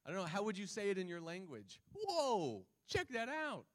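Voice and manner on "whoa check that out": high, amazed whoa; surprised exclamation. Shown as a demonstration. Preacher rephrases "behold" in everyday language, surprised. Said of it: "used to indicate surprise".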